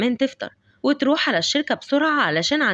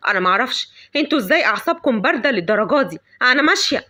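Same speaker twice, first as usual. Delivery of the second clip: angry outburst; 4 dB louder than usual. Acted. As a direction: blame the father in a fury. Mother raging at husband. (زعيق غاضب على الزوج)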